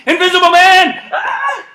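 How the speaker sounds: very high laughing shout; laughing call. Real, not acted. Coach calling the invisible command: high laughing squeal.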